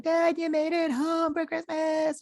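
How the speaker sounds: very high, drawn-out imitation of children; put-on high kiddie voice; mock excited kids. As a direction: squeal it slowly like kids greeting Dad. Voices kids greeting their dad.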